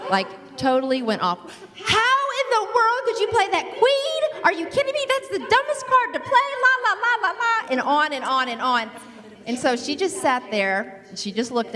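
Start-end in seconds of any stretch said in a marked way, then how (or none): 1.7-7.7 high-pitched retold tirade at her partner; hammed-up angry rant over a bad card; mock rant. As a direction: rant much higher, as if chewing her out. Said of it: "I totally went off on her".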